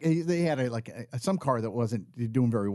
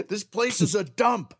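higher-pitched imitation of a tantrum; mock angry mother; 6 semitones higher than usual. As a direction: imitate her fed-up ranting. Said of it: "clench her jaw and she'd start throwing things".

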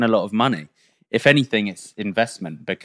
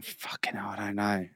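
hushed, breathless whisper of a worried wife; 14 dB quieter than usual. Acted. Wife whispering her worry to her husband in the cave. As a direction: whisper it like she's scared to be heard. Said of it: "the wife's going".

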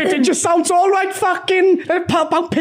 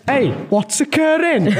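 slow Cardiff Welsh catchphrase; Cardiff accent. Acted. Son doing a Welsh-off in a slow Cardiff accent. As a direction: drawl it out like a Cardiff local. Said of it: "you're being more like Cardiff".

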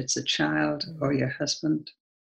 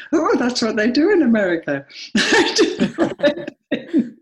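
laughing through the words, voice pitched up; 9 semitones higher, 4 dB louder than usual. Real, not acted. Telling the listeners about her own naivety, laughing. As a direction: break into real laughter at yourself.

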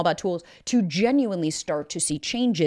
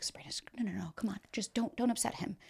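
urgent whispered plea, much softer; whispering mom; 3 semitones higher, 13 dB quieter, faster than usual. Retold or imitated. Mom urging her daughter not to upset him, whispering. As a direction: hush your daughter so he won't hear. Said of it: "and she was like".

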